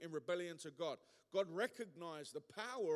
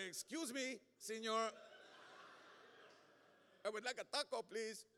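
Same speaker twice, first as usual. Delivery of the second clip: English in a higher-pitched, put-on Spanish accent; mock Spanish accent; 4 semitones higher than usual. Abroad without the lingo, asking for food in a mock Spanish accent. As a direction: ham up a tourist's Spanish accent. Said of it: "but in their accent".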